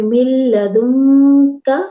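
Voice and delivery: मिल लदुन का very slow and loud, the का kept light; plain light kaaf. Shown as a demonstration. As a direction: drag मिल लदुन but keep the का light and simple. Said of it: "का, simple है". (हल्का का, धीमे और ज़ोर से)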